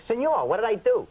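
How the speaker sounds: put-on high, loud Queens street accent; teenage student from Queens answering the teacher, New York accent; Bowery Boys. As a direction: tough New York kid talking back. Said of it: "You sound like one of the Bowery Boys".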